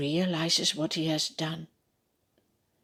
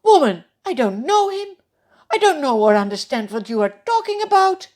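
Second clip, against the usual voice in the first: loud, much higher, fast heated denial; denial with an oath; 8 semitones higher, 8 dB louder, faster than usual. Acted. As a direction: swear it off in a hurry. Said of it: "this time even with an oath".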